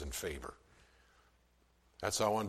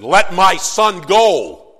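loud, high and slow royal command; commanding demand; 10 semitones higher, 19 dB louder, much slower than usual. Retold or imitated. Moses demanding Pharaoh release Israel, loud slow command. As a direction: plant your feet and demand it. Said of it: "and he said".

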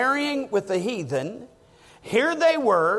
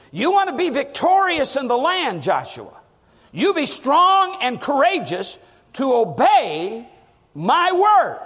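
raised, emphatic preaching voice; forceful exhortation; 5 semitones higher, 3 dB louder than usual. Acted. God charging Joshua to obey his word, forceful exhortation. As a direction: drive the command home, drawing out key words.

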